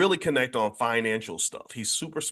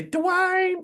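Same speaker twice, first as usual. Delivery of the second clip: very high put-on accent, calling a name; hammed-up high-pitched imitation; Whitley Gilbert. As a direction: squeal the name in a mock TV-character voice. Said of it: "Can you do an imitation of Whitley?".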